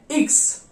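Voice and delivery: इक्स with short i; German iks. Shown as a demonstration. Teacher demonstrating German X as इक्स. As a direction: say इक्स, not एक्स. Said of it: "German में इसकी sound जो आती है इक्स".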